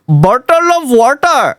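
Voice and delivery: high-pitched put-on Indian accent; exaggerated Indian accent with strong Rs in "Bottle of water". Shown as a demonstration. User demonstrates for the AI, Indian accent. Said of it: "do an Indian accent".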